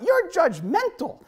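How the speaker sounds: a hammed-up accusing shout; mock yell. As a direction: yell it out at the preacher, drawn out. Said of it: "she ends up just yelling out".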